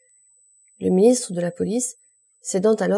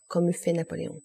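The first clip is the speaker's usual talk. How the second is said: usual speaking voice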